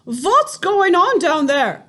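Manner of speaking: high, loud witch shout; shrill-free loud witch yell. A narrator's reading. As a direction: bellow it down from the platform. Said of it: "shouted the Grand High Witch".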